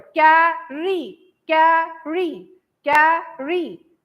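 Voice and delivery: "Carry" very slowly in two beats, कै - री; rhythmic "carry", long gap between कै and री; split into syllables. Shown as a demonstration. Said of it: "कै एक बार और री एक बार". ("कैरी" को लय में, कै और री अलग-अलग करके)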